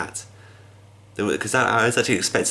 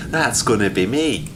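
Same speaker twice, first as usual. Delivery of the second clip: bright, eager and louder; excited; 4 semitones higher, 6 dB louder, slower than usual. Real, not acted. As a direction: jump in keen to take it on. Eagerly answers the game's challenge.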